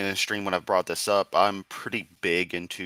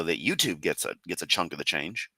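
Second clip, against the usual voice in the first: ordinary delivery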